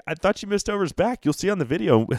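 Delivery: high-pitched retold excuse; mock apologetic guide; imitated apologetic guide.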